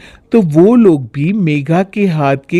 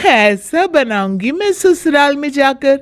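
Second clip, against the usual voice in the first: mocking sister-in-law; 9 semitones higher than usual. Sonam mocking bhabhi's cooking, teasing. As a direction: tease her about the food.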